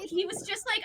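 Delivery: normal talking voice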